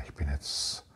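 hushed whisper of inner peace. Retold or imitated. Meditator telling himself he is at peace, whispered. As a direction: breathe it out as a secret.

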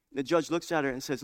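usual speaking voice

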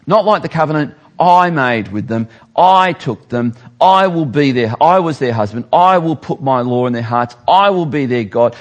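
loud, emphatic scripture reading; forceful preaching. Acted. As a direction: proclaim each promise with full force. Preacher reads God's covenant forcefully.

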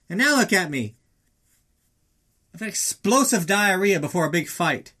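high, loud wail of suffering; hammed-up, strained bathroom tirade; agonized yell. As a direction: yell it out in gut-wrenching agony. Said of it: "she doesn't get the brunt of that yell".

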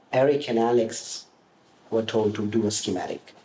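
ordinary talk

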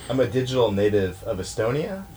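ordinary delivery

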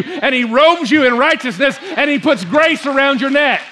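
excited, insistent sermon delivery in rolling phrases; fired-up preaching. Real, not acted. Preacher urging the congregation to drop their shame, fired up. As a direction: build repeated questions with real fervor. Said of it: "I'm getting kind of excited".

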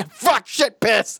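mock shouted tagline.

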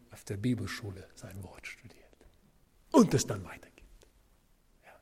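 hushed whisper, leaning in. Real, not acted. Preacher whispering to Bible school students.

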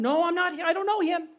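high-pitched protest; flustered, insistent denial; Peter's denial. Acted. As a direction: deny it defensively. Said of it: "He's a man named Peter".

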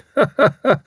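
short, high, barking laugh; blustering old commander's barking laugh; loud barking laugh. A narrator's reading. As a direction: bark out a hearty laugh. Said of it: "his loud, barking laugh".

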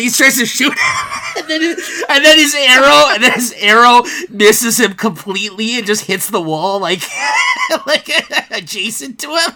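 high, loud, laughing storytelling; can barely get it out for laughing; laughing through it. Real, not acted. Fan describing the missed arrow, laughing hard. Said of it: "I'm laughing right now talking about it".

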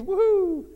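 high, loud "woohoo"; cheering whoop. Pretend. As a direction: whoop to stir up the congregation. Cheering the sermon series.

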